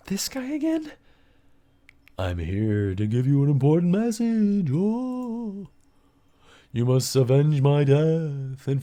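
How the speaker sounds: high, otherworldly ghost voice, slow; vision spirit. Acted. Dead mentor speaks in vision.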